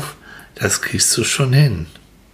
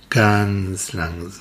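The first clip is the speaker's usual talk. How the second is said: "Ganz langsam" drawn out slowly; 2 semitones lower, slower than usual. Shown as a demonstration. Guide telling the child the carousel starts, slowly. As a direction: stretch "Ganz langsam" so it crawls along.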